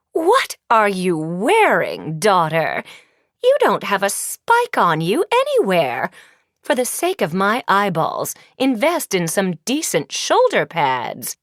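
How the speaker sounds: haughty wicked queen sneer; scornful evil queen. Acted. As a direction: look her daughter up and down with contempt. Evil queen mocking daughter.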